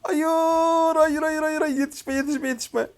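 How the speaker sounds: high, rapid, repeated cries of alarm; panicked yelping. Real, not acted. Gamer fleeing an enemy about to catch him, panicking. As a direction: beg the pursuer off as you flee. Panicked escape from pursuers in game.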